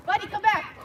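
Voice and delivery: high, loud call after a running dog. Real, not acted. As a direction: call him back from across the lot. Calling the dog back.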